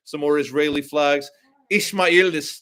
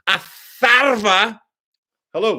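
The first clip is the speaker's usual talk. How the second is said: long, loud, higher call of the name; 6 semitones higher, 6 dB louder, slower than usual. Real, not acted. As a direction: stretch the name out like hailing someone. Calling out the viewer's name.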